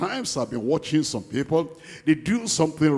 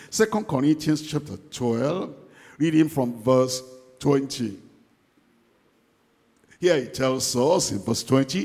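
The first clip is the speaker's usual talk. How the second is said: ordinary talk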